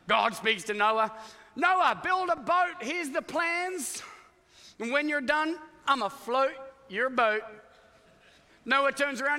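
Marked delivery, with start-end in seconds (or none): 1.5-7.4 booming God voice. Acted. Preacher voicing God's command to Noah. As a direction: boom it out as God handing down the plans.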